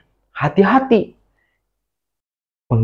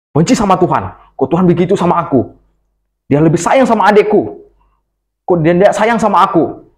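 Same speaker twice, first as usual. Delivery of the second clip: angry whining; 6 semitones higher than usual. A narrator's reading. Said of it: "Dia marah sekali". (Kain marah dan merengek keras kepada Tuhan)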